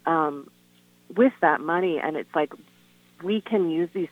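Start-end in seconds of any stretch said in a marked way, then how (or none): none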